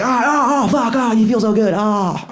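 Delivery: high-pitched mock moaning; hammed-up fake orgasm, then a sheepish apology; overdone moans of pretend pleasure; faking climax. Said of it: "you might as well just pretend".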